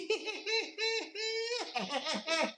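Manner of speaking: very high, soft villain cackle; long giggling cackle held down in volume; Joker cackle. Shown as a demonstration. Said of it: "I could do the laugh".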